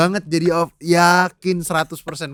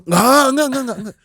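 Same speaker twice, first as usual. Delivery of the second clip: high, rapid-fire mock refusal; imitating a stubborn scriptwriter; insistent refusal; 8 semitones higher, 6 dB louder, faster than usual. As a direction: shoot the idea down, starting loud and high. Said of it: "penulis karakter bokap gue tuh udah kayak".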